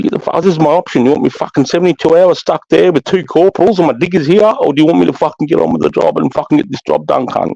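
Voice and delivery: high-pitched, heated protest; retold angry ultimatum. Acted angry showdown with superiors.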